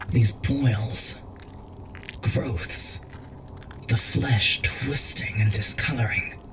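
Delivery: barely voicing what he sees; horrified whisper. Examining horrifying boils up close.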